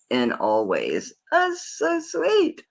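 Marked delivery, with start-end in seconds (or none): 1.3-2.6 high, slow gushing "so sweet". Real, not acted. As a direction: swoon over it, high and drawn out. Admiring a love inscription.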